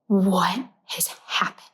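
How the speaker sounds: slow, hushed aghast whisper. Retold or imitated. Imitating shocked passengers around the cat in a whisper.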